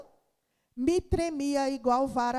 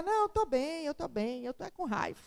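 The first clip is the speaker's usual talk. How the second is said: quiet reply; 7 dB quieter than usual. Retold or imitated. Answering worried bystanders.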